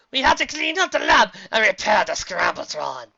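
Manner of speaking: loud, high cartoon scientist voice; hammed-up evil inventor complaint; pleading mad scientist. A narrator's reading. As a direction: protest loudly to your partner. Scientist protests to departing partner.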